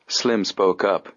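plain reading voice

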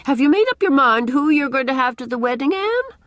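high, loud busybody matron; Mrs. Rachel Lynde, brisk questioning. A narrator's reading. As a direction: press Anne for an answer, voice lifting on the name.

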